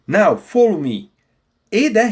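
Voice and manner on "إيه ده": "إيه ده" with the H left silent; "إيه ده" smooth, without any h breath; no pronounced H. Shown as a demonstration. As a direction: say "إيه ده" as a long open "ee" running straight into "da". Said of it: "we don't pronounce the H".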